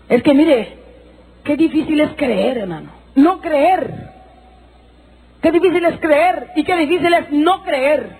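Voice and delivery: high, pleading preacher's voice; fervent preaching. Acted. Preacher urging the listeners to believe, fervently. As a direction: preach it to the congregation with fire.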